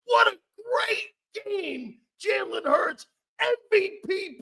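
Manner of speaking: high, slow, hollering praise; excited shout. Real, not acted. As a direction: holler it like a thrilled fan. Host celebrates Jalen Hurts.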